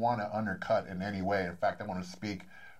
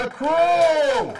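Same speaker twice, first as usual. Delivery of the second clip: hype shout; 12 dB louder than usual. Real, not acted. Hyping his crew to the room.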